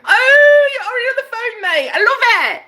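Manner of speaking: very high, hammed-up British squeal; delighted squeal. Squeals at the imagined call.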